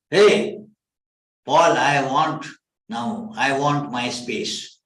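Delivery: loud, sharp call of a fed-up wife; a loud, mock-stern wife demanding her space; wife's demand. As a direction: belt it out like a wife who's had enough. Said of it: "my wife said in the morning".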